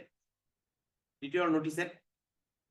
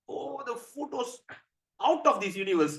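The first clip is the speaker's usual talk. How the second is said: excited raving; 8 semitones higher, 3 dB louder than usual. Acted. Narada telling Shiva about the meal, excited raving. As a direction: rave in ecstasy about the food. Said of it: "after hearing all this excitement".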